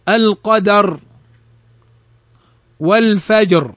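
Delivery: thick, dark final ر in القدر and والفجر; careful model words, القدر and والفجر closing on a heavy ر. Shown as a demonstration. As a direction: end القدر and والفجر on a full, emphatic ر. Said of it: "مفتوحا أو مضموما فخمت".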